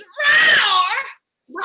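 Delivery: high, loud, long-drawn roar. Shown as a demonstration. Alien correcting the host's pronunciation, loud high roar. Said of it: "You gotta go high".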